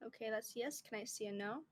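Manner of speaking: quiet and careful; hushed. Real, not acted. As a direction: murmur it softly. Hushed pendulum reading.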